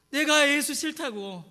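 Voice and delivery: loud, high, drawn-out refusal; defiant outburst. Acted. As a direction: act out stubborn rejection at full voice. Acts out past refusal of faith. (크게 소리쳐 거부하듯)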